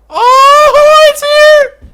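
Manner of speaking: very high, loud delighted squeal; excited squeal. Real, not acted. Player squealing as the beetle finally arrives. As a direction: squeal with joy.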